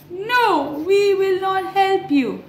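high voice saying no; refusing animals. Acted. Animals refusing the hen, lightly voiced. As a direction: turn the hen down together.

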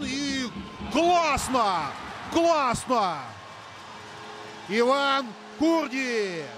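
rising shout of a goal call; excited hockey goal call. Real, not acted. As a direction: yell out the goal, stretching the name. Goal scored, commentator calls it.

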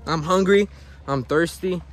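ordinary talk

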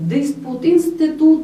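ordinary talk, nothing marked

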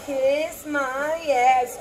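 very slow, stretched playful line; drawn out. Real, not acted. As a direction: stretch each word for the joke.

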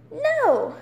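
sharp, loud, high outburst; real exasperated exclamation; emphatic no. Real, not acted. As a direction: slam the answer down. Answering own rhetorical question.

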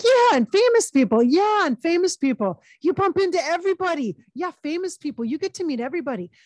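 high, loud put-on guy voice; imitated excitement, starting loud and easing off; excited imitation. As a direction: gush it as an eager, impressed guy. Channels Ray Liotta excitedly chatting.

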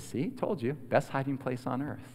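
soft, hushed satisfaction; quiet grandma. Retold or imitated. Grandma to emerging boy once others left, hushed. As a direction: say it softly, privately pleased. Grandma confides after children leave.

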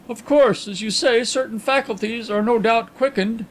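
high-pitched, placating concession; hastily considerate. A narrator's reading. Carlyle reassuring his blind friend, high and considerate. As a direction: soften the doubt with a quick courtesy. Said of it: "hastened to add considerately".

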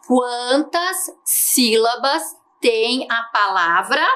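slow, measured read-aloud. Shown as a demonstration. Teacher reads the envelope's question to pupils, slowly. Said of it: "Vamos ler aqui".

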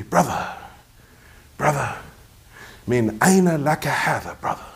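lightly imitated Boston accent, unhurried; a hint of Boston in a sheikh's questioning; mildly put-on Boston accent with weighty pauses. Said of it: "With his Boston accent".

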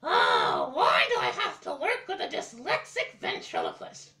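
exasperated witch shout. Acted. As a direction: yell it like a fed-up witch. Puppet mocks him.

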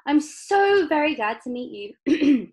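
loud, high, drawn-out delight; effusive greeting. A narrator's reading. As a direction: gush it slowly and heartily. Wonka effusively greets Mister Bucket.

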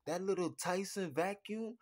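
normal talking voice

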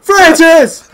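high, very loud name call; wailing shout. Real, not acted. Player calling Francis in the ambush, wailing shout. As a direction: cry out for your teammate.

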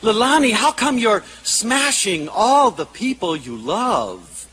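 high, drawn-out appeal; pleading disbelief. Retold or imitated. Therapist too involved with a child asks why she smashes, high plea. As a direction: ask it slowly and high, really involved.

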